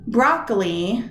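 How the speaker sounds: "Broccoli" with the stress on BROC; stress on first syllable. Shown as a demonstration. Teacher testing where stress goes, "Broccoli" stressed on BROC. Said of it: "where does the stress go?".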